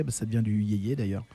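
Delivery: usual speaking voice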